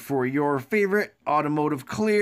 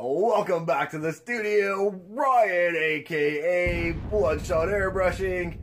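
usual speaking voice

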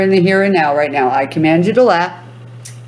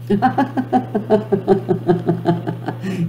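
demo laugh; 2 semitones higher, 5 dB quieter than usual. Shown as a demonstration. Host laughs to get viewers laughing. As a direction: laugh first so they join in. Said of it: "I command you to start laughing".

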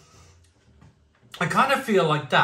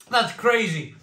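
ordinary talk

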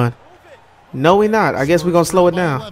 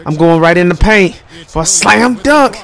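ordinary talk, nothing marked

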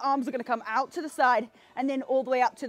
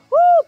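high whoop; 13 dB louder than usual. Real, not acted. Fitness cheer mid-workout.

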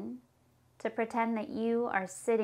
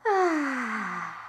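long audible breath out ah; exhale sound. Shown as a demonstration. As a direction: let the air go through the mouth. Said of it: "Big breath in".

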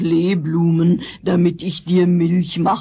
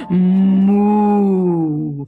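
cow moo. Acted. Cow character mooing.

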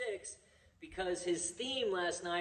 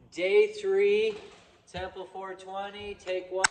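loud, clear film slate call; shouted slate call; 3 semitones higher, 13 dB louder, slower than usual. Shown as a demonstration. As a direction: call the take out loud for the clap. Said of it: "you go".

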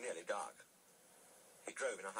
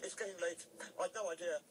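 high, loud, hurried; flustered and speeding up; panicked excuses; 11 semitones higher, 4 dB louder, faster than usual. A narrator's reading. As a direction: gabble excuses, desperate to get away. Thomas panics, makes excuses to flee.

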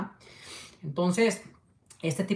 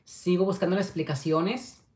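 ordinary talk, nothing marked

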